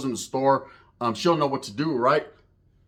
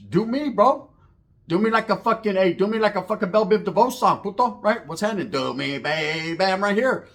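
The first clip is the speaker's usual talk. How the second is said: raised, higher-pitched defiant challenge; retold mounting anger; 6 semitones higher than usual. Father daring a gunman to shoot him instead, retold frustrated anger. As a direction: re-enact getting mad and daring them. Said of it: "I started getting mad".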